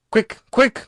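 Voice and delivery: high, loud, urgent; urgent cry. A narrator's reading. The ghost urging Virginia into the cavern, loud and urgent. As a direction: hurry her on in alarm. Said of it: "cried the ghost".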